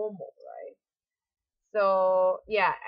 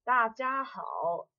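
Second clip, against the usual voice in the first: 大家好 said higher and slow, far voice; raised greeting demo; 5 semitones higher, much slower than usual. Shown as a demonstration. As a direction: call out 大家好 to the whole group, slowly. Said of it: "Then you can say".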